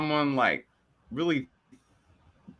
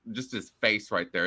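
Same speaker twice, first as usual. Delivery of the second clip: normal talking voice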